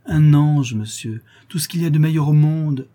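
loud, high, exalted declaration; fervent praise. A narrator's reading. La Louve describes the girl to the count, exalted. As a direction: proclaim it with swelling admiration.

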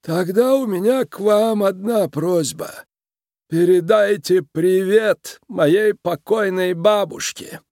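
high, slow creaky threat; grumbling creaky boss. A narrator's reading. Mob boss passes sentence on his captives, grumbling and creaky. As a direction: grumble it out in a tiny boss's creak. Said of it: "затем проворчал".

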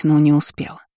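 ordinary delivery, nothing marked

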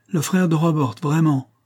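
put-on proud young heiress scoffing at the idea; scornful. A narrator's reading. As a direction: toss "frère" back with contempt. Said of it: "d'un ton qui ressemblait au mépris".

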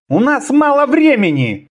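high-pitched, pressing menace; threatening. A narrator's reading. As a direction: warn with a threat in it. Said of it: "с угрозой произнёс".

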